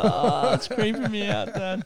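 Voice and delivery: put-on high, whiny kid voice; high kid whining to Dad; mock creeped-out kid. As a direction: squeal it like a grossed-out child.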